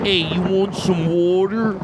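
slow, drawn-out Philadelphia accent. Shown as a demonstration. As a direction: stretch it out like a Philly local. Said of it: "that Philadelphia accent".

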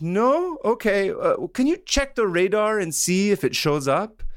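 high-pitched caller, politely asking; general on the phone. Retold or imitated. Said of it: "and he's like".

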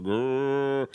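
mimicked toddler growl; imitated growl. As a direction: growl like a little kid shaving. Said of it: "she was like".

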